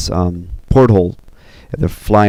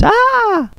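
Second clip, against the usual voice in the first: high-pitched mock scream; short storyteller's shriek; acted scream. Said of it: "and she screams".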